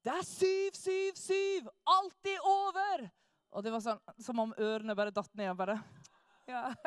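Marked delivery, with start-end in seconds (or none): 0.1-3.1 slow, higher, louder declaration; preacher answering the hecklers, slow, loud and high; triumphant proclamation. Retold or imitated. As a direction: drive each word home like a victory cry. Jørn's triumphant answer to the crowd.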